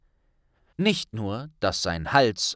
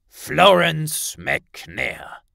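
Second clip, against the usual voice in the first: louder, slow, weighty man's voice; grave minister; 6 semitones higher, 8 dB louder, much slower than usual. A narrator's reading. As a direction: drop the name heavily. Kingsley enters speaking.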